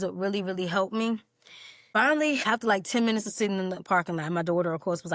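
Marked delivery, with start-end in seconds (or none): none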